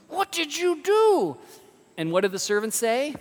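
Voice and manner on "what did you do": high, loud, drawn-out shock; outraged yell. Acted. King to servant who shot off his thumb, horrified high yell. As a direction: cry out aghast, pitch way up.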